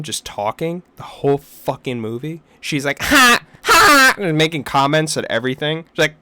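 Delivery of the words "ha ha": loud, high, brash ha ha; mocking fake laugh. Mimicking the talkative girl behind him, loud high fake laugh. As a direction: ham up an obnoxious laugh.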